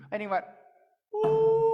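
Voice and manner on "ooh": loud, high howling ooh; coyote howl. Acted. Coyote howls his love song to the tree. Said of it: "coyotes singing in the middle of the night".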